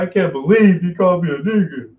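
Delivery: high, loud fake-hurt whimper; mock wounded whine. Mocking the hurt reaction the insulter wants. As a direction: overplay being offended. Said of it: "sarcastic mock wounded quote".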